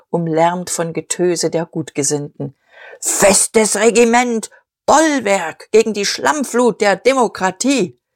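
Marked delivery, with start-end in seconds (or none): 3.0-8.0 higher-pitched, ringing political slogans; bombastic declaiming. A narrator's reading. Diederich rallying loyalists against democracy, bombastic cry. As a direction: orate over a roaring hall. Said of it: "Seine Kraftworte stießen rasselnd aneinander".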